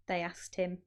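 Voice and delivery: usual speaking voice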